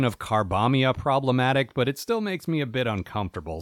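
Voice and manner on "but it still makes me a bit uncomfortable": ordinary talk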